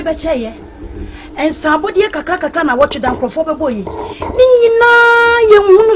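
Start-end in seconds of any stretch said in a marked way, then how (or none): 4.4-5.9 1 raised complaint. Acted. Complaining about missing drinks.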